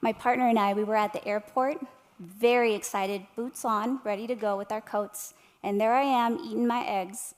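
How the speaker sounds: ordinary talk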